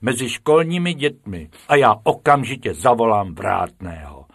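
loud, stern teacher's threat; cross schoolmaster; indignant scolding. A narrator's reading. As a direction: tell intruders off at full voice. Angry teacher threatens to call gatekeeper.

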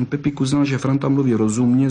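usual speaking voice

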